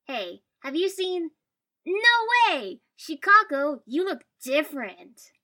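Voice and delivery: loud, high, drawn-out amazement; excited exclamation. A narrator's reading. Excited boy greets friend across street.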